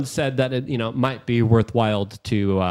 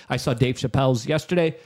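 ordinary talk, nothing marked

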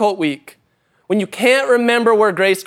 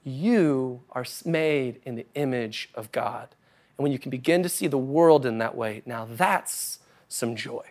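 tender preaching; 5 semitones lower, 8 dB quieter than usual. Acted. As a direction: bring it down to a tender hush. Tender sermon climax.

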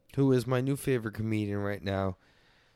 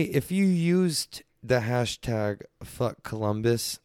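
normal talking voice

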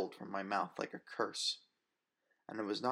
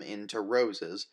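ordinary delivery

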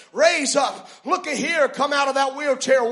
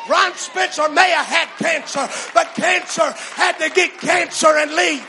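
fierce commanding prayer; 3 semitones higher than usual. Real, not acted. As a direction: shout the rebuke with full force. Rebuking Satan.

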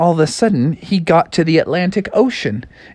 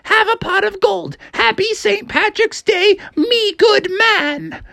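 very high, insistent mom voice; demanding mother. A narrator's reading. Mom challenging her son to bring a leprechaun, high and insistent. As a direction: lay down terms in a shrieky high voice, slowing at the end.

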